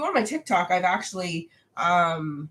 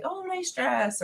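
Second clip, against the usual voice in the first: put-on high, slow girly compliment; sweet compliment voice; 10 semitones higher, 5 dB quieter, slower than usual. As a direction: coo a friendly compliment. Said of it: "kind of find a way to be like".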